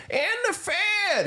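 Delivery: high, drawn-out parody of the congressman; imitating Ron Paul. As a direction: lampoon him in a high, slow put-on voice. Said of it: "listen to Ron Paul going".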